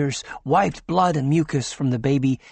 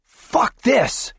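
higher, sharp angry yell; 10 semitones higher, 4 dB louder than usual. A narrator's reading. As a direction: snap and lose it. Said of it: "yelled McCoy".

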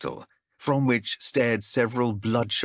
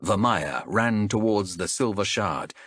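ordinary delivery, nothing marked

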